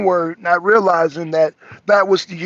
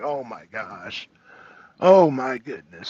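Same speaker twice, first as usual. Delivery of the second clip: exasperated groan; 7 semitones lower than usual. Retold or imitated. Frustrated with the distracted chat, slow low groan. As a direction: let it sag out, worn down. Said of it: "I'm just like".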